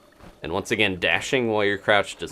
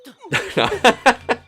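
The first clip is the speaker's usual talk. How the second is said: high, loud gleeful laughing; 8 dB louder than usual. Real, not acted. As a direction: let out a delighted laugh. Laughs as quest continues.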